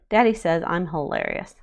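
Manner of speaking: ordinary delivery, nothing marked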